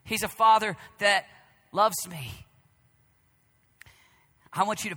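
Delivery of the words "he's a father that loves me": loud, high preaching voice; booming sermon delivery; forceful preaching. Acted.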